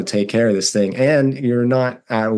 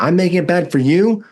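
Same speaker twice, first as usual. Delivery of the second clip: incredulous retort; 9 semitones higher than usual. Retold or imitated. Son snapping back at his mom, incredulous and high. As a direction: fire it back in disbelief, pitch climbing. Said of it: "my voice in that tone".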